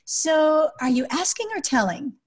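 light, lilting nudge with no scolding; lighthearted. Shown as a demonstration. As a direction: tease a demanding child lightly. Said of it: "in a lighthearted way say to him".

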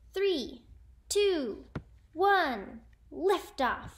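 loud, high launch count; shouted countdown. A narrator's reading. Miss Frizzle launching the bus, shouted countdown. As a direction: call each number out big and separate. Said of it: "Miss Frizzle shouted".

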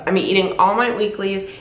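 usual speaking voice

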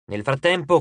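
plain reading voice

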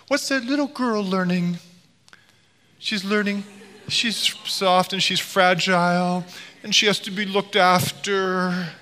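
mock-tender high lilt; delicate little-girl treatment. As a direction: lift the voice as if handling something fragile. Voices how a girl is treated.

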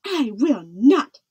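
slow, loud, emphatic refusal; shouting back. A narrator's reading. Princess defying the king's order, shouting back. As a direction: shout each word back defiantly. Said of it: "Isabella shouted back".

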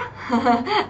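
soft real chuckle; pleased laugh. Real, not acted. Teacher pleased with ten top marks, soft laugh. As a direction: laugh softly, well pleased. Laughs after praising the scores.

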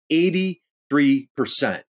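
"Eighty-three percent" said slowly, each syllable weighted; slow emphasis. Real, not acted. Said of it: "let that sink in for a second".

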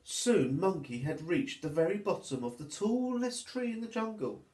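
put-on lively, sing-song reading for little children; storybook narration. A narrator's reading. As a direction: read it like storytime for toddlers.

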